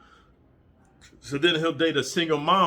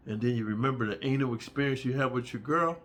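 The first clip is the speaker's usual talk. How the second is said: normal talking voice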